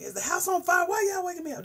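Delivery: high, loud shout from a jolted mom; imitating a mama's startled shout; mock angry yell. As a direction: yell at the kids for waking you. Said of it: "Wake up like".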